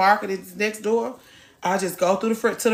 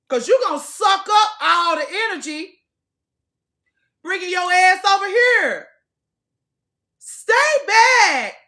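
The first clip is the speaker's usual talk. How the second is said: mock yelling; 7 semitones higher than usual. To the woman following her round the gym, mock yelling. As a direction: shout her away in mock outrage.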